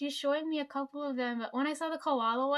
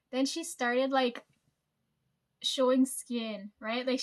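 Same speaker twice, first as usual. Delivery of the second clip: ordinary delivery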